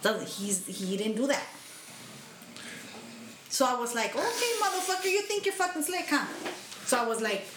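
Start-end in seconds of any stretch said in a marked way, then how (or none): 4.2-6.3 mock challenge. Mocking inner retort to coworker.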